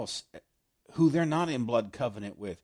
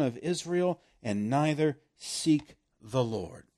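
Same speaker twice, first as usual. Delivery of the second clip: preached scripture reading; 4 dB louder than usual. Acted. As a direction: read it out like a prophet's warning. Reads scripture aloud with force.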